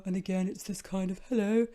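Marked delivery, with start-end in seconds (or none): 1.3-1.7 alter ego voice. Shown as a demonstration. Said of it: "when I talk like this".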